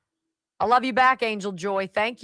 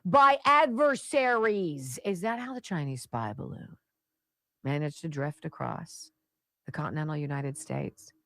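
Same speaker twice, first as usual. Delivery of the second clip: punchy scripted commentary read, higher and louder on key phrases; radio political commentary; 4 semitones higher, 4 dB louder than usual. Acted. Host lecturing listeners on national security, emphatic commentary. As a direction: deliver it like an emphatic radio monologue.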